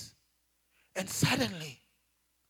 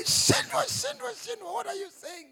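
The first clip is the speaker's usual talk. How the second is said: much higher, loud calling out; anguished cry; 11 semitones higher, 6 dB louder than usual. Retold or imitated. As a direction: cry out the name in dismay. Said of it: "in my heart, I say".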